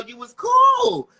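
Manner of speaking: high, slow, loud insistence; emphatic shout. Real, not acted. Woman insisting to her castmate in an argument, loud. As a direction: stretch it out and yell it.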